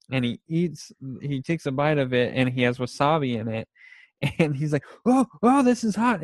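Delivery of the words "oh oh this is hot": burning mouth yelp. Retold or imitated. Jake bites sushi full of wasabi, high pained yelp. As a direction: yelp like the wasabi just hit your tongue.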